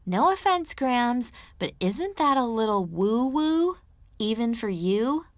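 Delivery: high, mock-prissy quoting of Zoe; mocking imitation. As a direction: parrot her words back mockingly. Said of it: "your exact words were".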